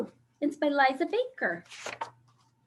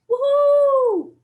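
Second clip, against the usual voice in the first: high, loud whoop; wild cheer; 12 semitones higher, 8 dB louder than usual. Pretend. Reading the wild page to children, high loud whoop.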